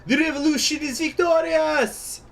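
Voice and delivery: put-on high, loud Russian-accented proclamation; cried out in triumph. Sablin toasting the revolution, loud Russian cry. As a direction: proclaim victory to a cheering hall in Russian accent. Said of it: "he cried".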